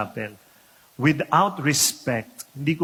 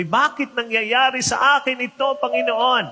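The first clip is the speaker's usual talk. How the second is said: higher-pitched acted plea, building in protest; questioning God; 5 semitones higher, 3 dB louder than usual. Good person asks God why, in rising protest. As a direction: complain upward to God, insisting on innocence. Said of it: "I also asked that question".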